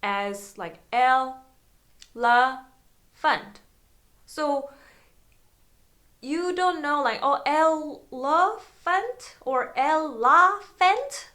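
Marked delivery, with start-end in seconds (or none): 0.9-3.6 "el, la, fant" in separate syllables with clear pauses. Shown as a demonstration. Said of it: "each syllables to be pronunciate". 7.5-11.3 "elephant or el, la, fant" drawn out, each try rising like a question; unsure sounding-out. Shown as a demonstration. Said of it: "you're not sure about that".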